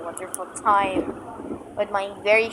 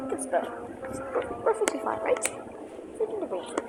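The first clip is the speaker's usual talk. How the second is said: whispering; 8 semitones higher, 10 dB quieter than usual. Pretend. Whispered mock reassurance.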